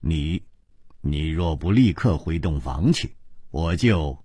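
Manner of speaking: low, quiet warning; stern, word by word. A narrator's reading. Hero orders the princess back to bed, stern. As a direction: measure out the threat softly and sternly. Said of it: "板着脸一字字地说".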